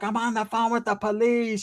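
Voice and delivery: high mock-female voice; mimicking a woman calling the cops; imitated frantic woman. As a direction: put on a high, wailing woman's voice. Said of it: "She's like".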